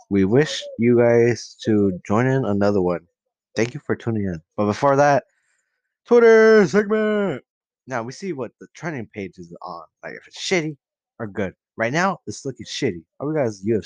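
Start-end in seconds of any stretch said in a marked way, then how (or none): 6.0-7.4 much higher, louder, slow showy intro; segment announcer. Acted. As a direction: belt it out like a radio host. Announcing next podcast segment.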